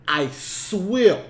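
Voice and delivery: normal talking voice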